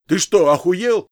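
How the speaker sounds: loud, high, outraged shout; outraged bellow; angry yell. A narrator's reading. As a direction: yell at your partner in disbelief. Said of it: "крикнул на него второй".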